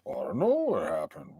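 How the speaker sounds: low, put-on know-nothing voice; feigned innocence. Friend imagines the culprit denying it, feigned innocence. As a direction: play dumb in a low voice.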